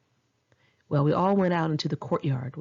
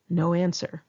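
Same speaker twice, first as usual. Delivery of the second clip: ordinary delivery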